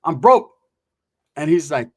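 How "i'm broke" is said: louder, hammed-up plea of being broke; put-on performance. He performs poverty for the rival, hammed-up and louder. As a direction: play up being broke for show. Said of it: "let me put on a performance".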